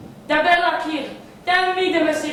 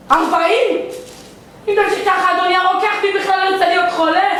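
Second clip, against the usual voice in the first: loud, higher-pitched outrage at a bill; indignant protest; 4 semitones higher, 4 dB louder than usual. Acted. Patient haggling with absent pharmacist, indignant. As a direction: protest the price at full voice.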